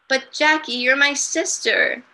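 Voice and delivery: put-on high, pleading little girl; lightly voiced upset child. Child Susu voiced in story.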